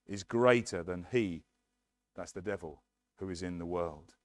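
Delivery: ordinary talk, nothing marked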